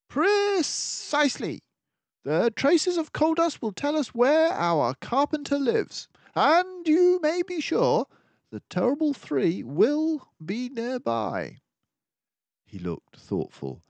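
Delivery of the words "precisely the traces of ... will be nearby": higher, brisk detective voice, urgent; a sharp-minded sleuth laying out the danger; keen detective warning of the villains; mouse detective. Acted. Detective triumphant deduction.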